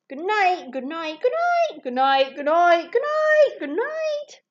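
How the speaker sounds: goodnights in alternating voices, several leaping very high; each animal chimes in, some high and loud; animals' goodnights. A narrator's reading. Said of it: "All the animals said good night".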